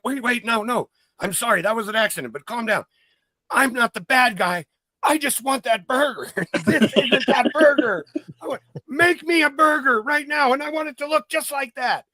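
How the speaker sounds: panicked high voice, half laughing in the middle; frantic gunman. Retold or imitated. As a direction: squeak out apologies, then insist on the burger. Gunman apologizes then demands burger.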